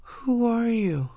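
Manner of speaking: groggy slurred high voice; slurred, dazed. Acted. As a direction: drag the words out half-conscious. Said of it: "She slurred the words".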